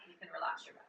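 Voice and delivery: unvoiced gentle whisper. Real, not acted. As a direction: whisper it kindly aside. Soft aside to tired students.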